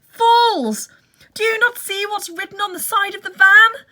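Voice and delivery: loud high furious shout; angry shout. Acted. Benjamin raging at the foolish animals, furious shout. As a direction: scold them at full voice. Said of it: "shouted Benjamin".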